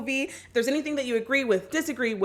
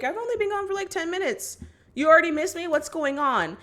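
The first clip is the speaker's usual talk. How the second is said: teasing dad; 7 semitones higher than usual. Retold or imitated. Scott teasing Charlie.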